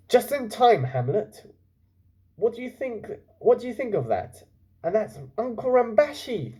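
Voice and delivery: put-on high, eager boy; excited boy voice. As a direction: point it out with delight. Chulak excited on arrival.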